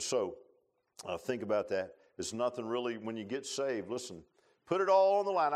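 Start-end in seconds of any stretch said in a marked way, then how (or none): 4.7-5.5 sudden loud, high urging; preacher's ringing appeal; loud exhortation. Real, not acted. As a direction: punch the challenge out.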